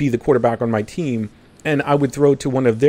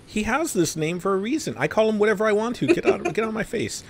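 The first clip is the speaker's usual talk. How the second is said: mock dismissive; 9 semitones higher, 5 dB quieter than usual. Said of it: "And I'm like".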